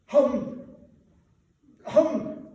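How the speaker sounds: loud, high, strangled grunt; the word sticks in your throat; choked humbug. A narrator's reading. Scrooge trying to scoff, choking on the word. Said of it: "word stuck in his throat".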